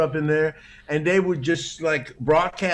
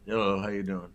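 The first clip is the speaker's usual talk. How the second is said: lower, much softer timid hello; shy reply; 6 semitones lower, 8 dB quieter than usual. Retold or imitated. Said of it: "I'm kinda shy and stuff".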